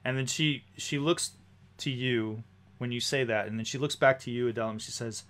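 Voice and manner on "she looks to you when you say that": ordinary talk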